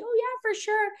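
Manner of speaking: mock eager agreement; eager quote. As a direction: chirp it happily. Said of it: "She's like".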